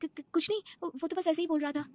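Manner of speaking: stammering fear. Acted. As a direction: stumble over the first words in fright. Wife stammers, caught by husband. (ऊँची, हकलाती, डरी हुई आवाज़)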